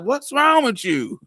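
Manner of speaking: high, loud, drawn-out question; startled demand. Retold or imitated. Stepdad to the crying boy, loud and startled. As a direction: imitate a stepdad taken aback by sobbing.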